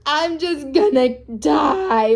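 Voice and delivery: sobbing gasp, then drawn-out wail; mock crying. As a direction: imitate a mother bawling theatrically. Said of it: "are on the floor crying".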